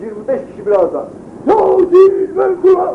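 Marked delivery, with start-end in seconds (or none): 1.5-2.9 high, slow, louder drawn-out cry of "Kur'an"; louder mock call. Shown as a demonstration. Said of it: "Daha çok bağır".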